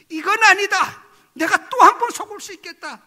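loud, high retold outburst; emphatic refusal. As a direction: burst out in alarm at being fooled, reliving it. 또 속지 않겠다는 다짐. (단호하게 높고 큰 소리로 부정하듯)

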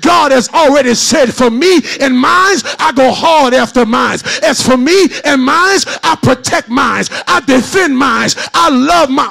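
chanted, whooping sermon cadence; shout-sing the sermon over the music; preaching at full force. Acted. Preacher whooping to the congregation, full force.

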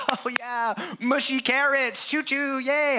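put-on high, slow toddler cheer; baby delight. Pastor imitating his babies cheering for carrots, high and gleeful. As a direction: squeal it like a happy little kid. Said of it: "and they're like".

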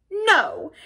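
high, stubborn refusal; sullen but stout. A narrator's reading. Toad refusing before his waiting friends, sullen and stout. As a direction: dig in and refuse, a little sulkily. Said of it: "a little sullenly but stoutly".

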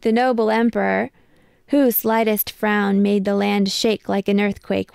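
plain reading voice

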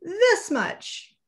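squeaky high, proud little boast; little hare stretching his arms, very high voice. Acted. Little hare shows his father, squeaky high voice. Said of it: "said Little Nut Brown Hare".

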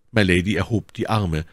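usual speaking voice

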